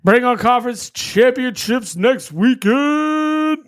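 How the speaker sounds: slow, loud hype call; hype announcer. Acted. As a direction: announce it like a big event. Hyped announcement.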